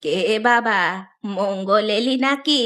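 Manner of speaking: very slow, drawn-out granny voice; old woman. Acted. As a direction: ask who's there as an aged woman. Old woman answers from inside. (বৃদ্ধার টানা, ধীর গলা)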